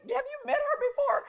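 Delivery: a squeaky high question; high mock surprise. Retelling the moment, squeaky high surprise. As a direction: pitch it way up in disbelief. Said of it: "I'm like".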